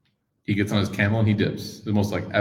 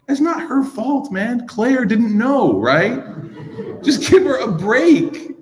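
high, slow pleading, laughing at the end; high slow plea to a friend; 13 semitones higher, much slower than usual. Retold or imitated. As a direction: beg him to ease up, amused.

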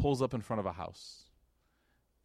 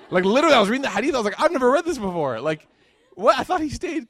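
excited disbelief; 11 semitones higher, 9 dB louder than usual. Real, not acted.

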